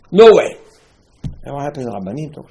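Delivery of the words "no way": loud, high outburst of refusal; emphatic refusal. Acted. Preacher voices the spies refusing the land, emphatic refusal.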